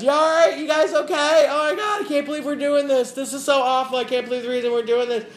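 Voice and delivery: high, loud calls across the plane rows; retold yelling. Friend on the plane yelling to them from economy, high and loud. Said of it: "she's yelling back and forth at us".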